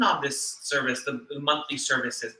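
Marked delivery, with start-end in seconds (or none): none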